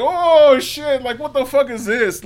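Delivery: high startled panic; panicked, high-pitched shock. Retold or imitated. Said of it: "I'm like".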